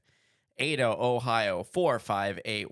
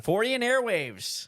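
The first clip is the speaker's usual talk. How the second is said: excited announcement; 12 semitones higher, slower than usual. Acted. Echoing conference name.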